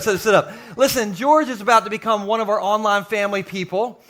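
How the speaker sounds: ordinary talk, nothing marked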